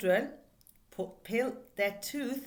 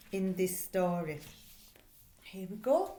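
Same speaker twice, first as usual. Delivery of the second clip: ordinary talk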